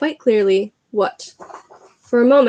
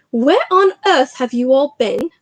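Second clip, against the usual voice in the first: louder, slow, indignant Fat Lady; startled portrait lady; 4 semitones higher, 5 dB louder than usual. Acted. Fat Lady questioning the breathless students, indignant. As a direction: demand where they have been.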